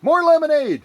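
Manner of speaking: high, loud rallying shout; shouted cheer. Real, not acted. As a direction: belt it out like a toast. Calling for more lemonade.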